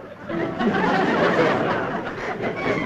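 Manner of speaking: cheeky little laugh at his own joke; sly chuckle. Acted. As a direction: chuckle like you just pulled one over on Dad. Son laughs after monopoly joke.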